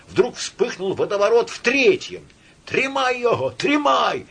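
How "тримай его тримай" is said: high, cracked cry; spiteful, whining shout. A narrator's reading. Man in crowd calling to seize the orator, spiteful. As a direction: yell with malice and a whine. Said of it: "закричал мужской надтреснутый, злобный и плаксивый".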